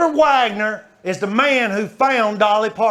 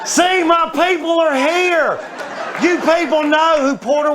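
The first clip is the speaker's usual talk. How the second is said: delighted shout to the crowd; 7 semitones higher than usual. Real, not acted. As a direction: hail the audience like long-lost kin.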